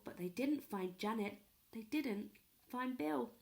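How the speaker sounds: plain reading voice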